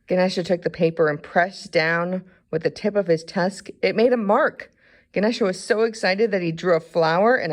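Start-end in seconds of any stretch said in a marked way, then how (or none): none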